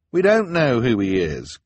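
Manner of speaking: emphatic protest; insistent. A narrator's reading. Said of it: "Klaus insisted".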